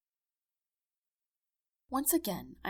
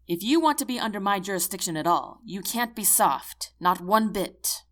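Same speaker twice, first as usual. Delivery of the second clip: stern lecture; 8 dB louder than usual. Acted. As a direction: lay down the law. General lectures private sternly.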